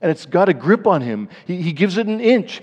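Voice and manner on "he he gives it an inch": ordinary talk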